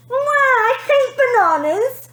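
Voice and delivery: high, loud, slow wail; a tantrum wail of a spoilt girl. A narrator's reading. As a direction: wail it like a bawling child.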